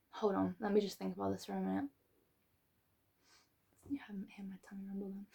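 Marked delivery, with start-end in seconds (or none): 3.9-5.3 fast whispered aside. Real, not acted. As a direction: rush it out in a secret whisper.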